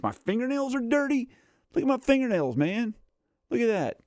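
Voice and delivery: high, squeaky mock-disgusted voice. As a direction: squeal over your own dirty nails. Shows off his dirty nails.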